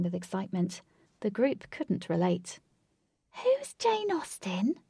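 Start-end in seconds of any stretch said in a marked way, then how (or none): 3.4-4.8 high, slow curious question; intrigued. A narrator's reading. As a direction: ask with wide-open curiosity, pitched way up. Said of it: "asked Jane with intrigue".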